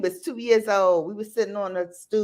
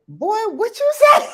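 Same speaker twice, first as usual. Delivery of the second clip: high, loud mock-outraged yell; shocked mother; 8 dB louder than usual. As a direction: shriek it in disbelief at a cheeky kid. Shocked mother retold to toddler.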